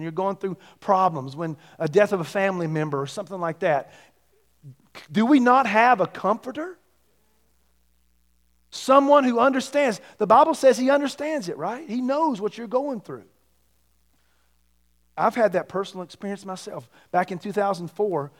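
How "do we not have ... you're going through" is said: high, loud rising preaching; booming motivational speech. Real, not acted. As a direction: lift them up with conviction. Preaching at full force.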